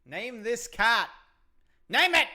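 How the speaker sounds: loud mock demand. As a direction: demand it, building to a shout. Mock demand to name cat.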